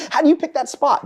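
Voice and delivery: high, amused mock question; laughing disbelief. Retelling the Marine's question, high and amused. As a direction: ask through laughter. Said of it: "He just goes".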